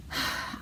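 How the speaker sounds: long deflated sigh; defeated sigh. Acted. Ramon giving up on drawing, heavy sigh. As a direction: let the air out, giving up. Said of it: "Ramon put his pencil down".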